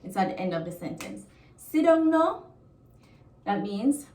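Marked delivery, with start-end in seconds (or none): 1.6-2.4 "Sit down no" high and loud, rising at the end; demonstrated request with a raised final "no"; high intonation. Shown as a demonstration. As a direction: lift "Sit down no" up high with volume. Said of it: "the intonation is kinda high".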